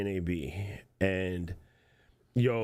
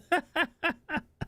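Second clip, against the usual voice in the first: high laugh. Real, not acted. Laughing at own joke.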